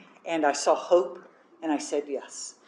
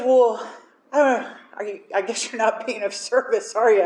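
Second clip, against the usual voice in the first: loud, pointed challenge; 4 semitones higher, 3 dB louder than usual. Retold or imitated. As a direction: call her out. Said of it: "she goes".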